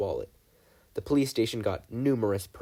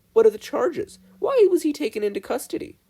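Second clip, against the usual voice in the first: much higher and louder, pressing; indignant demand; 12 semitones higher, 7 dB louder than usual. A narrator's reading. Mabel grilling agents about arrest, higher and louder. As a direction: demand it with rising outrage.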